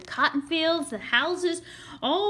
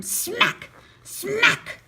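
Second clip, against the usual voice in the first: drum smack; 9 semitones higher, 5 dB louder than usual. Acted. Drum hits imitated.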